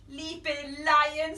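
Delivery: usual speaking voice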